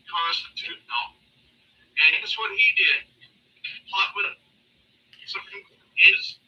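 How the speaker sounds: choppy, cutting out bad connection; breaking up. Real, not acted. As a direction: voice dropping in and out on a call. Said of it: "you're breaking up so bad we can't understand anything".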